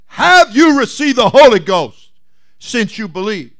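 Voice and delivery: loud, high pointed question. Retold or imitated. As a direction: press the question hard. Paul questions the believers.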